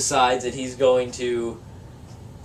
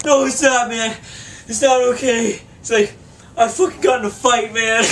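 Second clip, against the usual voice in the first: put-on higher, louder distraught drunk buddy; upset drunk friend; 7 semitones higher, 4 dB louder than usual. Drunk friend confessing a fight, high and distraught. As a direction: plead it high, like a drunk who messed up. Ryan voiced in retold story.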